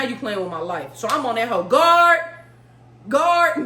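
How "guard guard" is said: loud, high call for the guard; mock yell for guard. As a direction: holler for the guard, jokingly. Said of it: "No, I ain't say guard".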